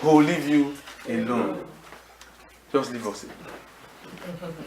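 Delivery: almost under the breath, pleading; hushed plea. Real, not acted. Pastor drops to hushed plea.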